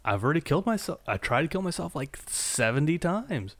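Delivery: rising to a high fast whine; mock Walter. Host voices Walter bored by his own suicide attempts, climbing to a high whine. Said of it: "he's like".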